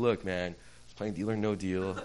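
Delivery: low, soft, measured appeal; calm reasoning. Retold or imitated. Customer explaining the situation at the counter, quietly reasonable. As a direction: reason with him, keeping it down.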